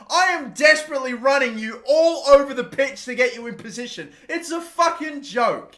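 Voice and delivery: high, loud frustrated venting; real exasperation; exasperated rant. Real, not acted. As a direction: rant at the useless team. Frustrated rant at his team.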